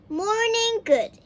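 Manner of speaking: bright high greeting, words back to front; cheery topsy-turvy hello; cheerful. A narrator's reading. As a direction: chirp it happily. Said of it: "he said cheerfully".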